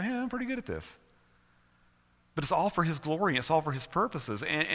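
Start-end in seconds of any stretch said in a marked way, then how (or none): 0.0-1.0 high, softer smug aside; mock self-satisfaction. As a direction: act quietly pleased with yourself, pitched up. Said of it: "sometimes you kinda go".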